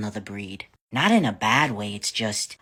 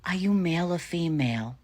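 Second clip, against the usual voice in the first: lightly voiced kid, pitched up; faux-innocent child; 6 semitones higher than usual. Kid trolling parent in a ritzy store, higher innocent-child question.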